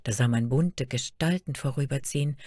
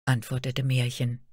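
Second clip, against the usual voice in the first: plain reading voice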